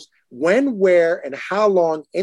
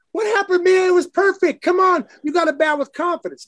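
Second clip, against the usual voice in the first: much higher, exasperated mock scolding; frustrated mom; 12 semitones higher than usual. Father imitating mom scolding their daughter for not swinging, much higher mock exasperation. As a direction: mimic mom fretting after the missed pitch. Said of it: "Mom says".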